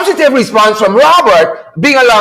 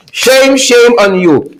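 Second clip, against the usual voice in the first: ordinary talk, nothing marked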